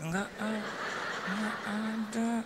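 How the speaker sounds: high, soft, drawn-out mock reply; lifeless wife. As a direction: drag it out as if the spirit has left. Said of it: "kayak nggak ada roh gitu".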